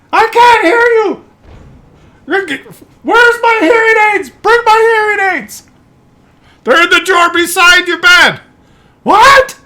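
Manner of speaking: put-on bickering old couple shouting; elderly parents yelling. Old parents shouting about hearing aids, high strained voices.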